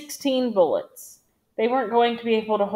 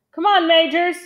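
high, raised yell to a comrade; louder, higher narrated battlefield yell; 7 semitones higher, 5 dB louder than usual. A narrator's reading. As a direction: yell it out, urging him on. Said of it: "Zimmer yelled".

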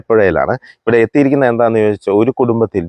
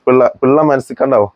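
usual speaking voice

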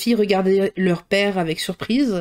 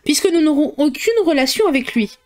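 a touch of bitterness, pitched higher; bitter; 7 semitones higher than usual. A narrator's reading. Mother bitterly answering her husband about Bingley, mildly bitter. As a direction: let the grievance sour the words. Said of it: "répliqua la mère avec amertume".

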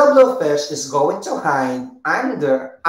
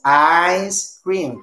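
"Ice cream" drawn out as two beats; split into syllables. Shown as a demonstration. Teacher confirming the two syllables, slow and split. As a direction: separate "Ice" from "cream" clearly. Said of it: "Ice cream has two syllables".

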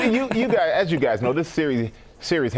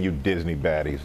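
low villain taunt; 9 semitones lower than usual. Acted. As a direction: growl it playfully low. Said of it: "I'm gonna say it again".

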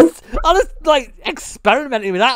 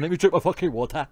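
ordinary talk

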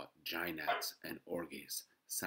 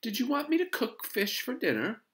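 high, loud, surprised mother; high-pitched puzzled mom voice; surprised question; 11 dB louder than usual. A narrator's reading. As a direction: ask in disbelief, rising at the end. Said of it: "Missus Huggins sounded surprised".

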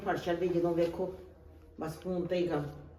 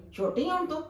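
higher, mocking jab; sarcastic grandma; 9 semitones higher than usual. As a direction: needle her for acting little. Grandma mocks grown girl.

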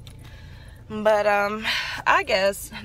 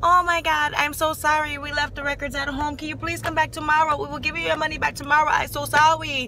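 lightly voiced apologetic worker, higher; imitating the worker's flustered sorry; a hint of a flustered nail salon worker; mock salon worker; 6 semitones higher, 5 dB louder than usual.

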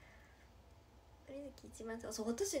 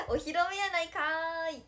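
loud, higher comic retort with a stretched ending; playful tsukkomi; 4 semitones higher, 10 dB louder than usual. Pretend. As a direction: belt out a mock-outraged comeback. Mock comeback to viewer's mistake.

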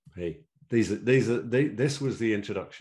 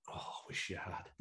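very soft, breath-only retold reply; rueful murmur; 15 dB quieter than usual. Club official to returning referee, rueful hushed sigh. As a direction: sigh it out under the breath, imitating him.

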